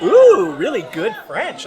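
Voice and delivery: high, loud delighted ooh, then the words; a tourist lighting up with delight; impressed ooh. Retold or imitated. French tourist impressed by the compliment, delighted high ooh. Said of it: "And she was like".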